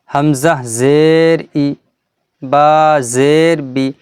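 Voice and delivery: drawn-out letter names, then ই and বি cut short; recited spelling with unstretched ই, বি; short final vowel. Shown as a demonstration. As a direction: spell it out measuredly, ending ই and বি without any stretch. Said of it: "টান কিন্তু একদম হবে না হারাকাতে".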